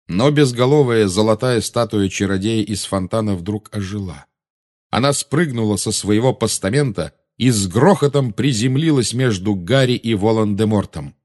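ordinary delivery, nothing marked

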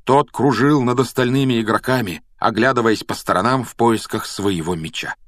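ordinary delivery

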